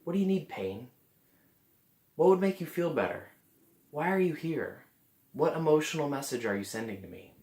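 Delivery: gentle nurturing inquiry to the pain; talking to the pain. Shown as a demonstration. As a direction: coax the pain like a friend. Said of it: "You might talk with the pain".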